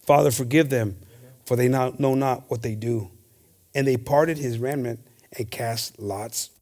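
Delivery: slow, solemn Bible reading; preacher reading the Gospel aloud to the congregation, solemn and slow; scripture reading. Acted. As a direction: read the passage slowly, like from the pulpit. Reads the crucifixion scripture aloud.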